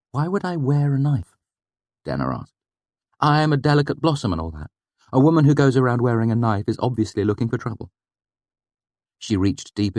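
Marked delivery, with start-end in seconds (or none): 0.1-1.4 mock innocent. Denner voiced, mock innocent. 3.2-7.9 mock delicate. Denner mocking delicate blossom.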